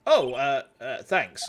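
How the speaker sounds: usual speaking voice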